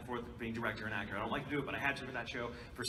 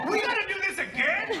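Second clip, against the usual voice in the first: high, loud incredulous cry; mock dismay; 9 dB louder than usual. Engineer facing the second hanky scene, loud dismayed cry. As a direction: imitate someone appalled, voice shooting up.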